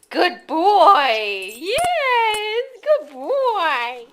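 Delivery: high sweet pet praise; praising a dog. Real, not acted. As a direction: praise the puppy warmly.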